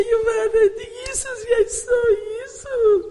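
high, loud crying shout of joy; ecstatic cry. Retold or imitated. Waking and crying out to her daughter that she saw Jesus, ecstatic shout. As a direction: cry out like a revelation just happened.

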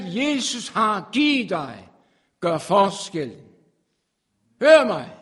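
full-force preaching. Acted. Preacher urging listeners at the meeting, loud and fervent.

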